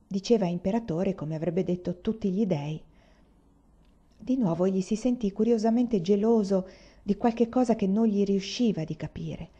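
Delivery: ordinary delivery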